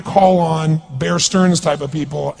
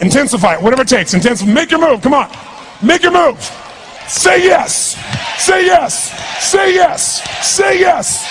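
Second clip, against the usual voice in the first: loud, much higher rallying shout; high-energy rallying; 9 semitones higher, 4 dB louder than usual. Real, not acted. Speaker urging a crowd to intensify, rallying shout. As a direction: drive the crowd on at full voice.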